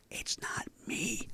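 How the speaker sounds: slow, voiceless stage whisper; whispered protest. Retold or imitated. Golfer protesting to the instructor on the side, whispered. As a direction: whisper it slowly, pleading innocence.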